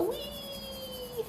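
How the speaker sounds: long, high-pitched wee; playful wee. Real, not acted. Drawing along for viewers, a playful high squeal. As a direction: hold a high, thin wee.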